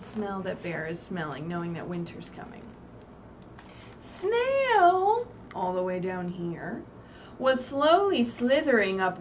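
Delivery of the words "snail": high sing-song on Snail; sing-song call. A narrator's reading. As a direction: lift the name up playfully. Teacher naming character in story.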